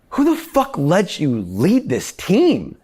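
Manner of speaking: imitated tirade, high and drawn out; furious boss impression. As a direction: berate him word by word, pitch soaring. Boss berates him, retold.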